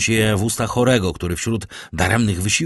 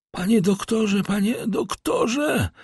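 high, pleading wail, slowing at the end; Barua calling out; 14 semitones higher, slower than usual. A narrator's reading. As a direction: dread of the coming attack. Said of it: "zawołał Barua". (wysokie, błagalne wołanie)

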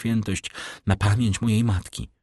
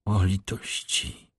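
slow, soft, drawn-out plea; a hushed lament of a desperate girl; pleading cry; 4 dB quieter, slower than usual. A narrator's reading. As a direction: beg for pity, slowly and softly. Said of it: "zawołała".

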